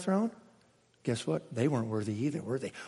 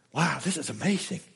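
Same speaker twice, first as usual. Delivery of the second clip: usual speaking voice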